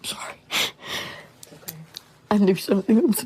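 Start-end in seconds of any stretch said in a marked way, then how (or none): 0.0-0.3 breaking down, barely whispering; whispered apology through tears; choked whisper. Real, not acted. Mother breaking down in interview.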